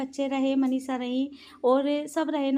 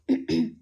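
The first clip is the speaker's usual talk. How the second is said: throat clearing; 4 dB louder than usual. Real, not acted. Sore throat mid-vlog.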